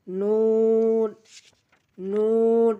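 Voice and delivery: very long, level "নুন" ending on the palate; tongue-tip sound. Shown as a demonstration. Teacher showing learners the letter, "নুন" drawn out evenly. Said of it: "জিহ্বার আগা তার বরাবর উপরে".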